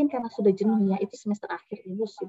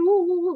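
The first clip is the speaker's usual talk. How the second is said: high, loud imitated child's sobs; mock sobbing; 8 semitones higher, 7 dB louder than usual. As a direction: sob in bursts like a child winding down. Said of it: "berhenti sedih".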